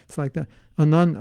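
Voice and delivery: ordinary talk, nothing marked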